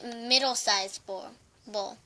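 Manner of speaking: ordinary delivery, nothing marked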